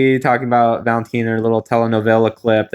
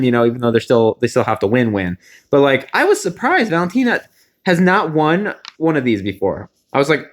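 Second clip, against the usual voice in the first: ordinary delivery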